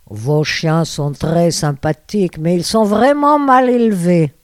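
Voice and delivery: imitated German accent, slow and emphatic; strong German accent. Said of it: "qui me dit avec un fort accent allemand". (fort accent allemand imité, lent et appuyé)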